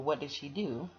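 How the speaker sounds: plain reading voice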